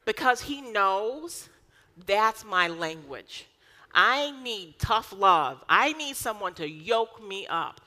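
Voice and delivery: ordinary delivery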